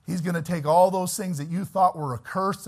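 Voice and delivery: usual speaking voice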